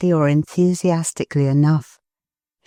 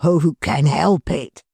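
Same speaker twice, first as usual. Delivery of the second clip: Mister Tetterby's cry. Acted. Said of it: "cried Mister Tetterby".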